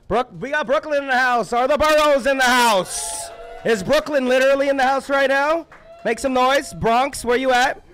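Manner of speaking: loud, high-pitched roll call; rally the room by borough; loud open-mic host working the crowd; hype MC crowd call. Real, not acted.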